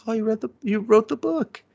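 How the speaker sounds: high-pitched imitation of his surprise; retold surprise. Man finding out she's written the book, surprised. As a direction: lift it high, as if just finding out.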